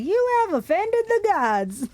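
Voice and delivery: high, slow, hammed-up Southern accent. Reader joking as the monster teacher, mock Southern accent. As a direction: stretch it out in a mock Southern squeal. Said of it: "Imagine a southern accent being like".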